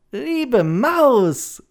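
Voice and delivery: high, loud delighted call; joyful cry. Acted. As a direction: cry out with joy. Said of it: "rief die Schildkröte".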